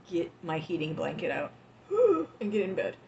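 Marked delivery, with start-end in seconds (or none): 1.9-2.3 a sudden high gasp; a loud startled intake of breath; sharp gasp. Real, not acted. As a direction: catch your breath sharply, surprised. Sudden gasp mid-sentence.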